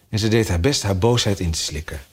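usual speaking voice

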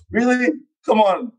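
high-pitched, mock-wounded disbelief; incredulous protest. Fencer retelling his reply to booing fans, incredulous.

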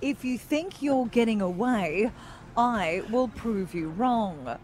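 slow, grave news-anchor delivery; newsreader voice. Acted. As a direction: read lyrics like serious breaking news. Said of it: "in her very best newsreader voice".

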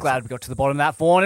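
usual speaking voice

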